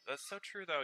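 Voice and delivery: ordinary talk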